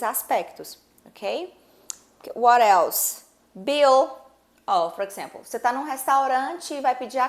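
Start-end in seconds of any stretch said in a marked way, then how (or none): 3.5-4.1 "Bill" higher and louder, with a clear L; final L pronounced. Shown as a demonstration. Teacher giving the next example, L pronounced. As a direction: ring out the L ending "Bill". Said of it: "esse L, ele tá lá".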